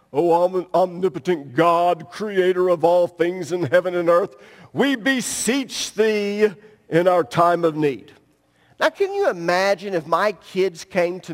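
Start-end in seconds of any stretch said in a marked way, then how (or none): 0.1-8.1 mock King James prayer. Pastor mocking people who pray in King James dialect, lowered pompous voice. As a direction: ham up a lofty thee-and-thou prayer. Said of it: "start talking in some kind of King James Version dialect".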